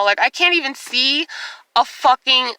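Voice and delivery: usual speaking voice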